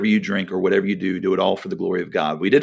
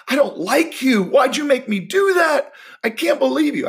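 put-on angry kid shouting. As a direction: shout it up high like a fed-up child. Boy's angry inner thoughts, retold.

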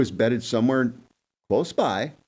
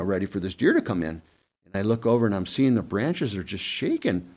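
normal talking voice

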